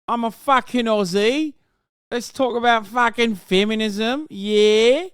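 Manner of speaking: put-on broad Australian accent, swearing and hyped; mock Aussie bloke. Co-host mocking the feminist guest in a broad Australian accent. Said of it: "most British person on earth".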